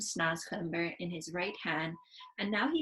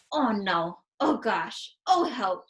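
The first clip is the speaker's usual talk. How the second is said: louder, spluttering in disgust; disgusted spitting; 6 dB louder, slower than usual. Acted. Sophie spitting out the vile vegetable, loud disgust. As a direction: spit out each cry in revulsion. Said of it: "she spat it out quickly".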